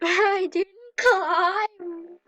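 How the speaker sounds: slow, loud words through laughter; laughing. Real, not acted. Gamer laughs at her own failed climb, slow laughing words. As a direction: laugh it out at yourself.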